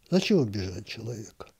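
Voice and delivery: ordinary delivery, nothing marked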